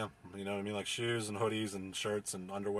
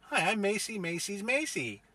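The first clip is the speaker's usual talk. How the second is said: higher, slow, silly put-on girl voice; lightly mock the coworker's sing-song intro; mock Macy voice; 10 semitones higher than usual. Mocking the coworker Macy introducing herself, in a higher, slow silly voice.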